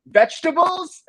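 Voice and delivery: put-on high, loud eager kid guess; squeaky mock kid answer. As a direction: blurt it out like a hopeful kid. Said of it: "And the fat kid's like".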